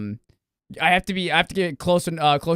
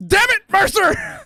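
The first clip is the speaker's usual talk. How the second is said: imitated exasperated shout; 7 dB louder, slower than usual. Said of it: "who goes".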